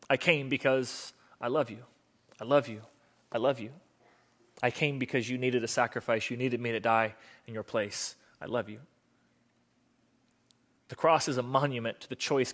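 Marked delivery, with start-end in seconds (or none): 0.1-8.8 soft, low declaration of love; tender. Acted. Jesus tells people why he came to die, tenderly. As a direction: drop to a quiet, tender voice, sinking lower.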